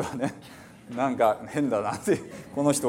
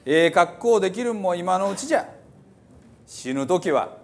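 put-on reading scripture in old Hiroshima dialect, preacherly and raised; 6 semitones higher, 4 dB louder than usual. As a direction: declaim the psalm like an old Hiroshima elder. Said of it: "ちょっと古い広島弁ですね". (古い広島弁で朗読)

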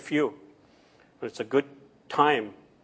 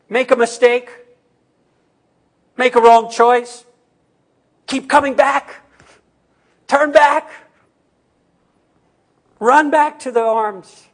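loud, high, pleading call to come back; preaching appeal; 10 semitones higher, 9 dB louder than usual. Acted. Pastor urging listeners to run back to God, loud and high. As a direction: call them home with full force.

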